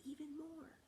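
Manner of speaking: soft high coaxing; soft playful urging; hushed coaxing. Acted. Urging kids to bend lower.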